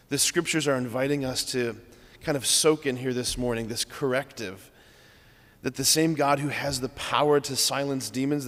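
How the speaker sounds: usual speaking voice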